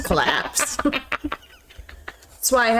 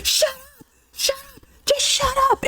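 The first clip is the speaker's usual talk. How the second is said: much higher mock scream of a frazzled teacher; retold teacher scream. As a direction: imitate the teacher losing it at the kids. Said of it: "she just screams at us the top of our lungs".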